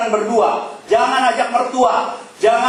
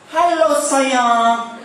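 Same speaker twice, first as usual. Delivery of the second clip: mock sweet greeting; 5 semitones higher, much slower than usual. Wife sneaking up on husband.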